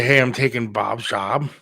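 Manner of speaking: normal talking voice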